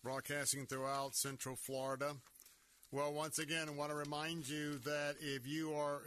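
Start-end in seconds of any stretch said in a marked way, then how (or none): 0.0-2.1 radio host announcing. Acted.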